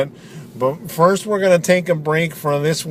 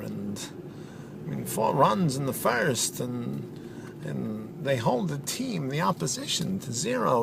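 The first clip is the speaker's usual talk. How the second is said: softer, halting, oddly paused delivery; a hint of Christopher Walken, choppy pauses; deadpan Walken-style commentator; 3 semitones lower, 11 dB quieter than usual. Softball pundit answering host Dutch in halting, low-key impression.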